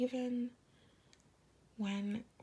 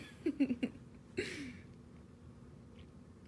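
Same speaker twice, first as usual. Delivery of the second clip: breathy laugh; 6 semitones higher than usual. Real, not acted. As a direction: laugh while a little teary. Laughs after tender promise.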